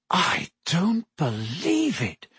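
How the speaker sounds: slow, hushed, hoarse astonishment; hoarse disbelief. A narrator's reading. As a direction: draw it out hoarsely, stunned. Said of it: "he said hoarsely".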